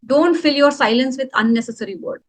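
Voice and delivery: ordinary talk, nothing marked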